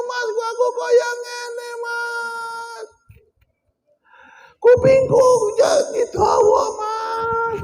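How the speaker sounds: screaming wife. Acted. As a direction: shriek like a frightened wife calling her husband. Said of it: "ibu-ibu teriak-teriak". (bojo bengok-bengok nyeluk bojone kanthi suara dhuwur)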